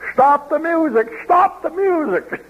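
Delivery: high, urgent cry to stop the music; mock halt of the whole congregation; interrupting shout. A convert halting the hymn singers, high urgent cry.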